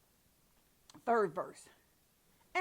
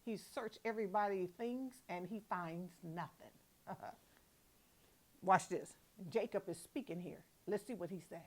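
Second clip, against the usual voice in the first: usual speaking voice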